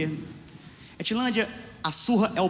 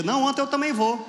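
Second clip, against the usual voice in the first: put-on silly kid; 9 semitones higher, 5 dB louder than usual. Dumb kid quoted.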